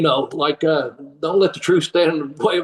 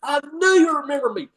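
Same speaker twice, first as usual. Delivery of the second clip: high, triumphant mock exclamation; excited fan; 8 semitones higher, faster than usual. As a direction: imitate a vindicated, delighted fan. Excited fan being recognized, retold.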